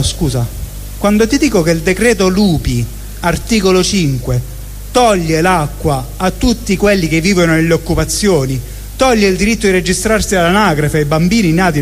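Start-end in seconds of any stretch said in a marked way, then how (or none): none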